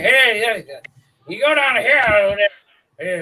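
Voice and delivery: lips-barely-moving country talk. Retold or imitated. Imitating guys who talk without moving lips, loud mumble. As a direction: call out through motionless lips. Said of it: "they don't move their lips when they talk".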